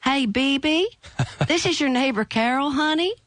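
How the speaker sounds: high, sweet motherly neighbor voice; neighbor Carol. Retold or imitated. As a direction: sing it out high like a kindly Southern neighbor checking in. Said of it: "And I get this".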